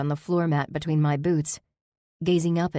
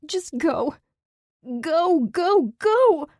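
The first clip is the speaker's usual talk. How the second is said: starts pleading, climbs much higher and louder to a shriek; building to hysterical shriek; 9 semitones higher, 4 dB louder, much slower than usual. A narrator's reading. Fleeing Zach, begging him away, building to hysterical shriek. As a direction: push him away, rising into a desperate scream. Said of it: "The last word was a hysterical shriek".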